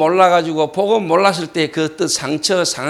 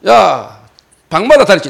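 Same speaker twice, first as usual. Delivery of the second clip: retold angry call, louder; loud summons; 2 semitones higher, 8 dB louder than usual. Pastor searches every room for culprit, loud call.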